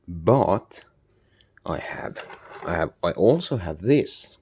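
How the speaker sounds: normal talking voice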